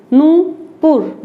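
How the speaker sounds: नूपुर slow, with a long clear ऊ; slow pronunciation demo. Shown as a demonstration. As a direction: draw out the ऊ in नूपुर for the class. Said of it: "तो बोलकर देखिए नूपुर". (नूपुर धीरे, लंबा ऊ खींचकर)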